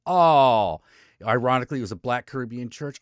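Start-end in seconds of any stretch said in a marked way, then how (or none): 0.1-0.8 loud surprised oh. Real, not acted. Reacting to headline.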